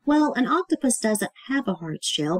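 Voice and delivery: usual speaking voice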